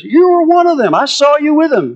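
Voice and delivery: put-on a second girl pointing the finger at Peter; accusing servant girl. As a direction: call him out like a sharp servant girl.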